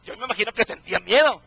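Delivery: fervent pulpit preaching, pitch and volume lifted. Acted. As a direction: preach it out to the congregation at full force. Preaching at full force.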